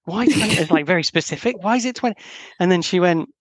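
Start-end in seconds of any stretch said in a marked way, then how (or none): 0.0-0.5 higher-pitched puzzled questioning; mock alarmed query. Boyfriend puzzled by partner's twenty minutes, mock alarm. 1.6-2.2 high-pitched puzzled question; mock bafflement. Boyfriend questioning partner's exact timing, mock bafflement.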